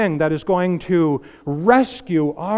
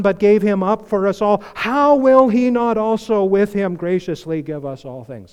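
raised preacher's voice reading Romans; preaching scripture; 5 semitones higher, 4 dB louder than usual. Acted. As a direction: proclaim it to the whole church. Preaching scripture at full force.

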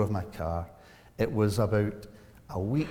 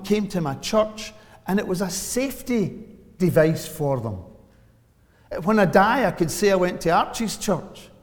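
fervent preaching; 5 semitones higher, 6 dB louder than usual. Acted. Preaches from the pulpit.

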